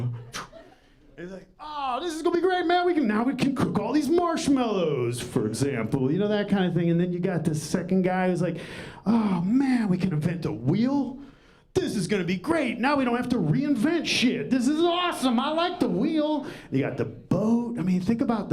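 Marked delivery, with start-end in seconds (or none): 1.6-5.2 high, fast, giddy caveman voice; pitched-up excited bro. Retold or imitated. Caveman excited about fire. 9.1-16.5 high, gushing over the wheel; giddy high-pitched caveman; excited caveman. Retold or imitated. Second guy excited about wheel.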